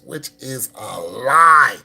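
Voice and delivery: LIE stretched out long and loud; slow build to a loud, drawn-out "lie"; emphatic debunk. Real, not acted. Calling out a scam's false promise, emphatic.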